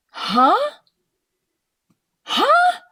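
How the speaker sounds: high, loud disbelieving huh; incredulous huh. Real, not acted. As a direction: yelp it in disbelief. Incredulous reaction.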